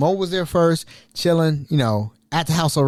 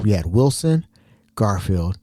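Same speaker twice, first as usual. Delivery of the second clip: ordinary talk, nothing marked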